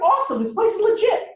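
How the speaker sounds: jumps way up high, delighted and eager; thrilled. Retold or imitated. Road-tripper hearing the mosque caretaker's number works, thrilled. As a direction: burst out with sudden joy at good news.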